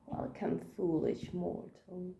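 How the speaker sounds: low, slow, hushed spooky greeting; Phantom Manor ghost host. Retold or imitated. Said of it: "talk along with all the spiels".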